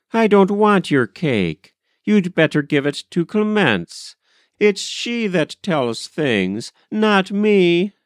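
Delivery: high, emphatic hurt protest; upset refusal. A narrator's reading. Molly rejecting cake before crying, upset refusal. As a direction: refuse hotly, pitch jumping up.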